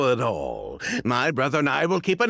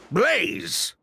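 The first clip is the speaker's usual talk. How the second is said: calling out; 12 semitones higher than usual. Acted. Calling distracted brother sharply.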